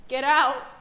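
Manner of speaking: ordinary delivery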